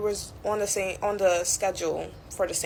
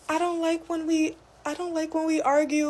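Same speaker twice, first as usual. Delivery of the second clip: much higher, whining plea; whiny coworker; 10 semitones higher than usual. Retold or imitated. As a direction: mimic her childish whine, pitched high. Coworker's plea after ignoring her.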